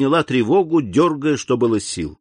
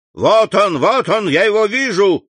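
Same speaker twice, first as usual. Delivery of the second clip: louder and higher shout of triumph; 8 semitones higher, 4 dB louder than usual. A narrator's reading. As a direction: call out spotting the fugitive. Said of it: "крикнул голос за дверью". (громкий крик унтер-офицера)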